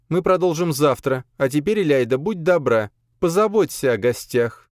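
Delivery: high-pitched, composed woman giving orders; lady of the house. Acted. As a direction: hand out instructions in a high, polite voice. Narrator voices lady giving orders.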